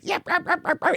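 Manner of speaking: very high, quick little yaps; yippy dog barks. Retold or imitated. Tiny dogs at the hallway end, high yipping barks. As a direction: imitate a tiny yappy dog barking. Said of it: "little tiny yippy dogs are just like".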